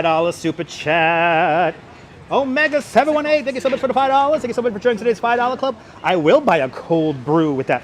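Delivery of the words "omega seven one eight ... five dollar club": higher, fast cheerful thanks; excited thanks. Real, not acted. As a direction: gush over the donor. Thanking a donor.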